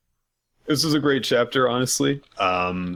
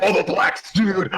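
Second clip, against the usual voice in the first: Hulk Hogan; 8 semitones higher than usual. Acted. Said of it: "he too perfectly traced him".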